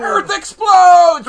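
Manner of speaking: slow, high, loud announcement; dramatic shout. Acted. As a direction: shout it like a disaster trailer. Announcing doom, shouted slowly.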